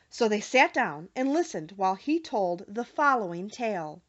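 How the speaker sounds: ordinary delivery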